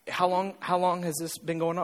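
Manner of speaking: low, soft questioning, as if thinking it; pastor's inner voice. Retold or imitated. Pastor privately questioning a struggling churchgoer, low and soft. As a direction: voice a private thought, low and restrained. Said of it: "in the back of my head, I'm going".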